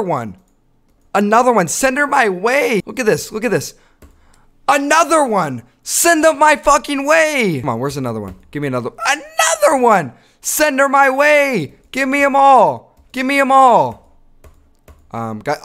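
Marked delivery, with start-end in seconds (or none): 1.1-7.6 hyped calling. Real, not acted. Hyped calls for matches. 9.1-14.0 high, slow, stretched hype yell; hype shouting. Real, not acted. Hyped yelling about matches.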